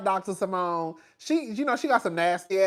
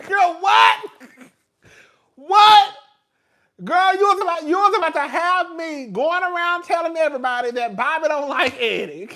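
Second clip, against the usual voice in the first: high, very loud mock-outraged shouting; hammed-up yelling at a friend; outraged disbelief; 11 semitones higher, 11 dB louder than usual. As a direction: explode in comic indignation. Erupts in mock outrage at lie.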